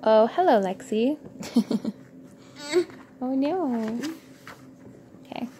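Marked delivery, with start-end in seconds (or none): none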